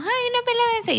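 hammed-up surprised squeal; mock startled squeal. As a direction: act out a tipsy, startled little cry. Retelling her own near-miss throw.

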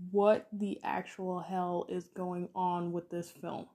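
low, hushed, slow disbelief; stunned disbelief. Retold or imitated. Podcaster recalling the movie moments that floored them, in hushed disbelief. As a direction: drop low and slow, dumbfounded by it. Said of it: "made me go, like".

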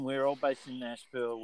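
ordinary talk

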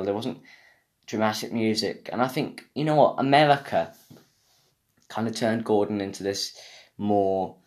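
ordinary talk, nothing marked

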